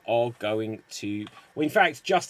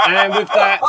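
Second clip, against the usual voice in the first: laughing; 5 semitones higher, 14 dB louder than usual. Real, not acted. Host laughing while wrapping up scene.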